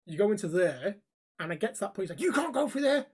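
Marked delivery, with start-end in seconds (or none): 2.2-3.1 high-pitched officious objection; retold angry warning. Stranger stopping him at no entry, mock-angry. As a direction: imitate an indignant busybody forbidding entry. Retells stranger blocking his way.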